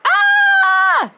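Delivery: piercing high shriek; scream. A narrator's reading. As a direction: scream in terror. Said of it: "Grandma Georgina screamed".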